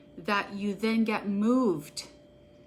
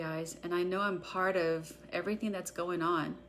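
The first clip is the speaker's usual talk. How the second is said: a guided EFT tapping script read for followers; guided tapping meditation; 3 semitones lower, 9 dB quieter than usual. Acted. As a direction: call each tapping point, then the affirmation, at an even unhurried pace. Leads a guided tapping session.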